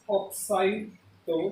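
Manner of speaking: ऑक्साइडो drawn out very slowly syllable by syllable; slow spelling out. Shown as a demonstration. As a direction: stretch ऑक्साइडो out slowly for the class. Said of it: "ये क्या हो जाएगा?". (ऑक्साइडो को धीरे-धीरे खींचकर बोलना)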